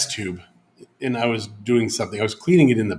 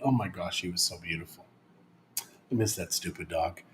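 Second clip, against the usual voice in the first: wistful; 5 semitones lower, 14 dB quieter than usual. Real, not acted. Missing her late dog.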